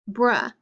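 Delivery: "bruh", brush cut off before its sh; final sound dropped. Shown as a demonstration. Teacher showing children brush without its last sound, final sound dropped. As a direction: say "bruh" with no sh at the end. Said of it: "brush without the sh is just".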